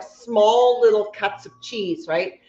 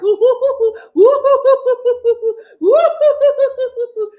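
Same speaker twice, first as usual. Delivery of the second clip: loud, high hooting hoo-hoo laugh; Gouda laughter; 10 semitones higher, 7 dB louder than usual. Shown as a demonstration. Host demonstrates Gouda laughter, loud high hoots. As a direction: hoot in repeated waves of laughter. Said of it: "Gouda laughter".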